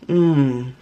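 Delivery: mmm of pleasure, mouth full; savoring mmm. Real, not acted. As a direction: hum with delight while chewing. Tasting brownie.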